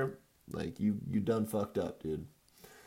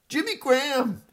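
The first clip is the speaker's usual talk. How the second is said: anguished yell; 6 dB louder than usual. Real, not acted. Cursing player again.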